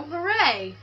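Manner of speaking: a mild mock cheer; half-hearted hooray; lightly voiced celebration. Celebrating controller working.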